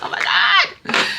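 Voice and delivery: high, slow, breathless gasp of panic; panicked whisper. Retold or imitated. Remembering stage fright as a child, high panicked whisper. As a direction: gasp it out like a terrified kid. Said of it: "I'm just like".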